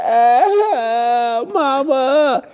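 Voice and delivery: high mock wail for mama; crying out. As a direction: wail in panic, half laughing. Retold cry of fear.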